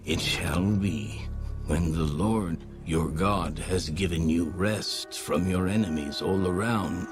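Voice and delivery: normal talking voice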